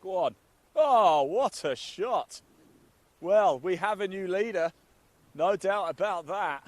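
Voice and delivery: loud, high, thrilled commentary; excited shouting. Real, not acted. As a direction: roar it as the ball lands. Big shot lands, new leader.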